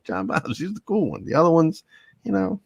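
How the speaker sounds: high, giggling throughout; mock fan gushing through laughter; laughing mock quote. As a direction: gush it while laughing. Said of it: "It's like".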